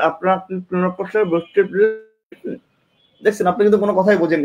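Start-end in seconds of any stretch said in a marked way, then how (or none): 0.1-2.6 slow, muffled words with the tongue pinned; garbled speech, tongue pinned; tongue held still. Shown as a demonstration. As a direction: speak with your tongue held in place. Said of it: "জিহ্বাটাকে আপনি এভাবে আটকান".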